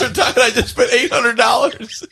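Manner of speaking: hammed-up outrage over the gift bill; mock outraged shout. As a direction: shout it slowly like you've been robbed.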